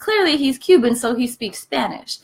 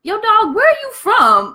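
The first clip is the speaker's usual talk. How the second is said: high, loud street greeting; South Side guys; 12 semitones higher, 5 dB louder than usual. Pretend. South Side guys quizzing Yule about his accent, high and loud. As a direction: hit it like a curious South Side guy. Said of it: "they gonna be like".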